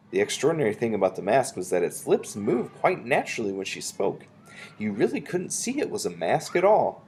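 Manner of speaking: ordinary delivery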